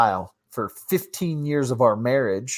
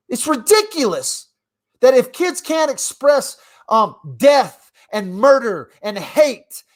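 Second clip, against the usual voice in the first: indignant rant; 7 semitones higher than usual. Real, not acted. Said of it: "y'all got me worked up now".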